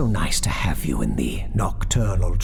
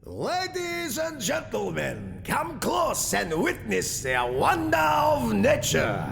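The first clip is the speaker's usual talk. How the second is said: fake Russian accent; 11 semitones higher than usual. A narrator's reading. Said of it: "shouting in his fake Russian accent".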